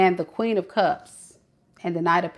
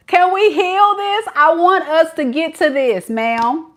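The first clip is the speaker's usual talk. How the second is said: higher, louder plea; pleading boyfriend; 8 semitones higher, 5 dB louder than usual. Retold or imitated. Reader voicing the boyfriend pleading with Shay, higher and louder. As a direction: ask to work it out.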